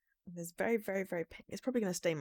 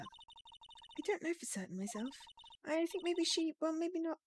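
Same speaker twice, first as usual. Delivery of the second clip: higher, softly uncertain character voice; hesitant game character; 10 semitones higher, 4 dB quieter, slower than usual. Acted. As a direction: voice a sister who is not sure. Voices Ema, unsure about her sister.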